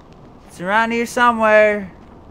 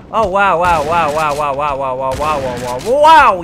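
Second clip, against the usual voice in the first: wow chain sliding lower then jumping up; playful wows; 5 semitones lower, 6 dB louder than usual. Real, not acted. Gamer marveling, wow chain sliding down and back up. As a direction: toy with the word wow.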